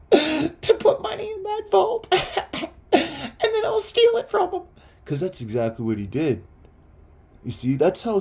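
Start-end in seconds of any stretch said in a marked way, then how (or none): 0.0-4.6 high voice breaking into laughter; mock scheming cackle. Don privately gloating over stealing the money, high cackling laughter. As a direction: imitate a gleeful schemer laughing between phrases. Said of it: "And he's like".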